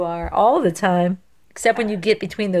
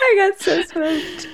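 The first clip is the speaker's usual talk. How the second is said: laughing; 10 semitones higher, slower than usual. Real, not acted. Losing the quiz.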